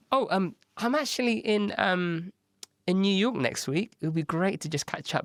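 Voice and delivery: high, breezy pretend casualness; mock-nonchalant offer to meet; feigned casual. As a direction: sell the fake coincidence lightly. Feigning casual trip to client.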